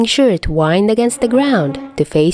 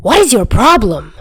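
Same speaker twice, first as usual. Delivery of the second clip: angry; 4 semitones higher, 4 dB louder than usual. A narrator's reading. Chuya stands up angry.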